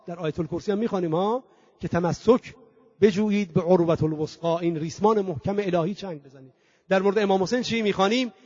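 ordinary delivery

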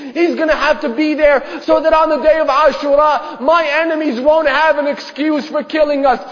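loud, high, impassioned preaching voice; anguished father's declaration. Acted. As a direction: cry out the father's refusal with full fervor. Father explains the sacrifice to daughter.

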